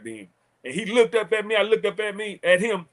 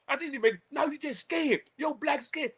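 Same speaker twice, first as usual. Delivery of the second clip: mock taunt; 9 semitones higher, 6 dB quieter than usual. Mocking the coach during a quarrel.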